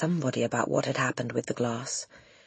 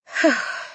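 relieved sigh; 11 semitones higher, 7 dB louder than usual. Acted. Teacher relieved after the storm.

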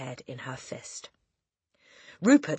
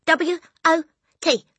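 put-on high, loud child's voice; Eric; 13 semitones higher, 11 dB louder than usual. A narrator's reading. Boy spelling for the headmistress, high voice. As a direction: call out the letters in a high voice. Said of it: "Eric said".

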